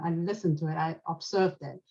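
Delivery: usual speaking voice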